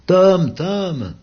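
high, loud call across the hill; calling the dog. Acted. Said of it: "en appelant". (appel aigu et fort au chien)

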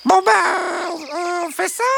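slow, drawn-out uncertain start; hesitant question. A narrator's reading. Hen asking others whether to vote, hesitant. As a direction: drag out the opening, unsure. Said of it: "questionna Bernadette".

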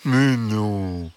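lightly voiced, innocent fox; reassuring fox. A narrator's reading. Fox denies he would eat hens, reassuring. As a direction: deny it with drawn-out innocence. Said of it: "répondit le renard".

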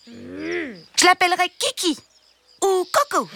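put-on high, whiny child voice listing wishes; a spoiled little prince insisting; demanding child prince. Spoiled prince demanding everything from his parents, high and whiny.

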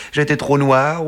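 high, slightly stammering protest; a touch of embarrassed fluster; flustered excuse. A narrator's reading. Secretary excusing his forgetting by drink, high and mildly flustered.

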